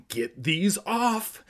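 high, slow, strained demand; frantic drawn-out order. A narrator's reading. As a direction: plead urgently, stretching each word. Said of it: "demanded in a frantic whisper".

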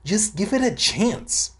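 put-on high, slow pleading invisible kid; a lightly voiced pushy kid; [name]'s voice.

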